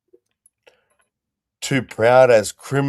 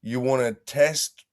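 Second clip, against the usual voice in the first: high, loud mock challenge; calling out; 8 semitones higher, 6 dB louder than usual. Bloke calling out a mate to fight, high, loud mock challenge. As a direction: square up to him for a fight. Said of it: "that is calling out".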